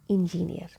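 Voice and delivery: "engineer" with an "in" start; mispronounced engineer. Shown as a demonstration. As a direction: say "engineer" as in-ji-neer. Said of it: "But this is not engineer".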